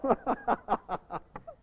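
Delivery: high staccato ha-ha; hammed-up jeering laughter; mocking laugh. Mocking laugh after the taunt.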